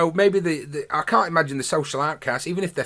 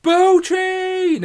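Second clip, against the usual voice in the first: mock hype shout; 7 dB louder than usual. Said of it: "Bo just looks up and goes".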